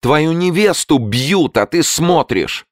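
very high, slow anguished cry; high, drawn-out acted wail of reproach; tearful cry. A narrator's reading. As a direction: cry out to someone in the crowd, stretching the words. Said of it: "с рыданием прокричала".

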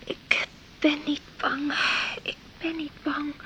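hushed, breathy self-reassurance; scared whisper. Acted. Girl alone in bed whispers to calm her fear. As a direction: whisper it to yourself, trying to be brave.

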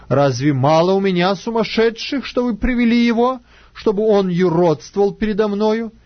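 understated royal indignation, pitch climbing; indignant questioning. Acted. King rebukes his servants for bringing him a madman, indignant.

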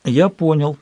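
ordinary delivery, nothing marked

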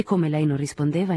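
ordinary delivery, nothing marked

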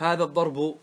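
الضرب with ending -u, "ad-darbu"; final damma. Shown as a demonstration. Teacher listing case endings, الضرب as "ad-darbu". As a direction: voice the last vowel of الضرب as u. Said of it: "لما تقف تنقل الحركات".

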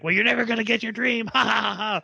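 high, fast gloat into an evil laugh; hammed-up gloating, ending in ha-ha-ha; mock villain taunt. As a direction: taunt them like a cartoon villain.

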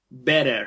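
"Better" with the T as a quick soft tap; soft tapped T. Shown as a demonstration. Teacher explaining the tap to listeners, "Better" with soft tapped T. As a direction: tap the middle of "Better", sound the final R. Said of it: "like a soft R".

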